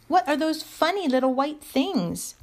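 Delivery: put-on high-pitched little girl's question; curious child. A narrator's reading. Lucy asking about the mittens, high curious question. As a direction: ask high, with childlike wonder.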